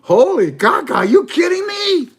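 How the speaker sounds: very high, loud squeal of disbelief; incredulous yelp. Retold or imitated. He hears the prime minister's promise, squeals in disbelief. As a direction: shriek it like you can't believe it. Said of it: "I say".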